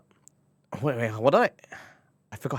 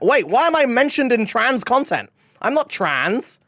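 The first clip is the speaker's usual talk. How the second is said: high startled disbelief, louder; surprised; 9 semitones higher, 5 dB louder than usual. Real, not acted. Streamer reacting to chat, startled and surprised.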